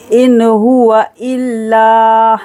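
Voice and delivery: Quranic recitation. Shown as a demonstration. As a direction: recite it slowly. Recites verse. (धीमी तजवीद तिलावत)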